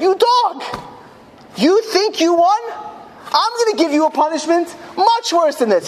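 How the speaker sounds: high-pitched retold outburst of rage; angry threat. Nazi guard punishing a defiant prisoner, high angry mock yell. Nazi's furious threat, acted in story.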